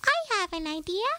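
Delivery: bright high mouse exclaiming; excited young mouse. A narrator's reading. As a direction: pipe up with an idea. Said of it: "he exclaimed".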